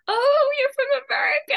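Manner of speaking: very high, loud gushing imitation; mock excited squeal. Viewers jumping to conclusions about the streamer, mock excited squeal. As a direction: squeal it like a thrilled fan, hammed up.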